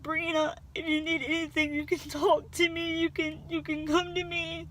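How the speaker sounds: crying as she retells his words; tearful. Real, not acted. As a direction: hold back the tears while quoting him. Her own voice breaking in grief.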